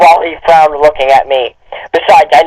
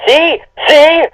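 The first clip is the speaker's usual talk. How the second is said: mock squeaky see; 11 semitones higher than usual. Mocking the fic.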